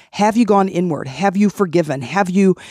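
ordinary talk, nothing marked

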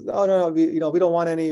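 storyteller voicing a villager who won't be bothered. Villager turning the fox away.

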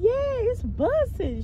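imitated kid, high and stretched; emphatic high schoolgirl assurance; fourth grader confirming. Fourth grader reassuring teacher about hairstyle, emphatic kid voice. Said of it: "she a fourth grader".

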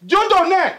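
high-pitched cry of disbelief; shocked shouting. Acted. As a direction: call out the name in shock. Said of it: "no shout no where I beg".

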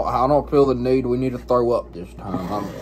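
ordinary talk

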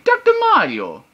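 high, loud cartoon impression; Mario. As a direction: sing out the name in a big high voice. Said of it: "I sound almost like Mario".

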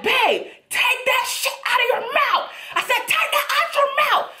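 high-pitched shouted command; reenacted angry scolding, voice way up; retold pissed-off yelling. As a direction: yell it like a fed-up, scared partner. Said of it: "I was pissed".

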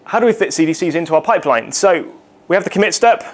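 ordinary talk

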